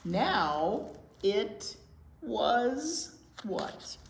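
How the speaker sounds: steady storytime read-aloud voice; gentle storybook narration; picture book reading. Acted. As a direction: read it to the little ones, clearly.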